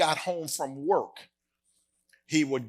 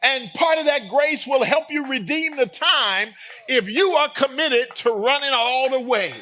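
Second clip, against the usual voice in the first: higher, louder full-force sermon delivery; preaching; 9 semitones higher, 4 dB louder than usual. Acted. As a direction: drive it home to the congregation.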